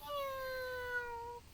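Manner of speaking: high meowing cat voice. Pretend. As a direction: meow like a little cat. Imitating a cat.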